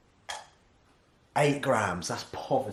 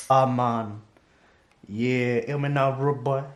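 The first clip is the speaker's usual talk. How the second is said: louder, put-on Jamaican accent; 7 dB louder, slower than usual. Shown as a demonstration. Vlogger showing off an accent to viewers, put-on Jamaican. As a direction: call it out in a mock Jamaican accent. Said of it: "That's my Jamaican accent".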